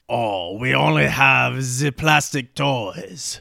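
put-on French-accented lament, stretched out. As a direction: play a slow, put-out French sailor. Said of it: "the French guy is like".

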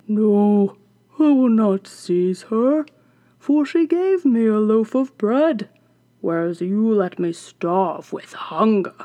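loud, slow growly dog; a loyal dog refusing; gruff dog voice. Acted. The dog answers witch.

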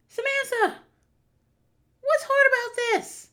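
high, slow, pleading disbelief; exasperated high plea. Acted. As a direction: beg her to see the obvious.

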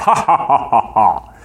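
mwahaha like a cartoon villain; evil villain laugh. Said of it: "there's this big, evil villain laugh".

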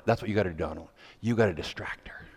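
low whisper passing on the plan; whispered instruction. Retold or imitated. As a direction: hush it like a conspirator. Whispered instruction to distract sister.

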